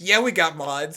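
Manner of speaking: loud, high, smug mock boast. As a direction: shout it like a proud fake announcement. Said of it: "standing in the corner".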